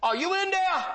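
very high imitated older man's call; put-on high voice, calling for the preacher; Herbie. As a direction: call down the hall in a high, odd voice. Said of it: "I can hear him".

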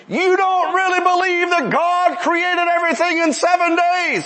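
high-pitched mock scream of an angry priest; retold angry scream. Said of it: "began screaming".

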